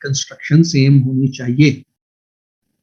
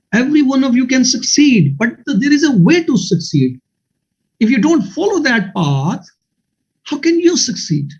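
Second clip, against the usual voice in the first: earnest exhortation; 7 semitones higher, much slower than usual. Real, not acted. Teacher exhorting students, high, slow.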